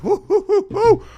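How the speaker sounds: high, loud whoops of delight; excited whooping. Real, not acted. Host cheering the commissioner's defiant statement, excited whoops. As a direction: cheer like your team just scored.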